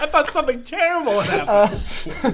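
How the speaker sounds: high laughing voice. Real, not acted. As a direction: laugh through the words. Laughing at own panic.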